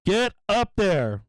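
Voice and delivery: will the price up, shouting at the screen; repeated high cheering command; urging shout. Real, not acted.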